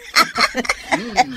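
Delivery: a bright, high burst of laughter; happy laugh. Acted. [name] laughing with delight as Feli is surprised.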